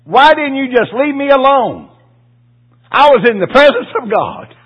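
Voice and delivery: high, loud pleading cry; impassioned cry. Retold or imitated. Preacher voicing his dying wife, a loud high cry. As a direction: cry out the question to the heavens.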